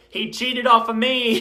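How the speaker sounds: fuming over the classmate who cheated off him; indignant outburst. Real, not acted. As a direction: spit it out like it's still unfair. Outraged about cheating classmate.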